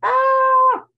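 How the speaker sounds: high, loud mock cat screech; voicing the startled cat's yelp on camera; cat's squawk. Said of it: "He's like".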